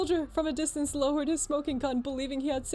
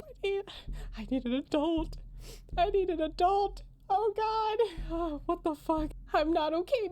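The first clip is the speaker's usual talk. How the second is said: much higher, half-crying panicked laughter; real shock; 9 semitones higher than usual. Real, not acted. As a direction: fall apart after the scare. Streamer reacts in shock.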